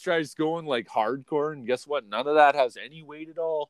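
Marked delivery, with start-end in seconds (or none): none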